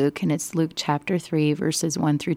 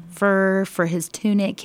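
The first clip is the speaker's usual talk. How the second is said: ordinary delivery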